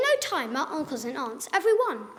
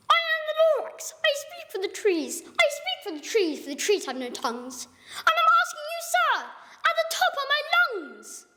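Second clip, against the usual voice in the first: bossy Lorax; 10 semitones higher than usual. Acted. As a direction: scold him at the top of your lungs. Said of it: "a voice that was sharpish and bossy".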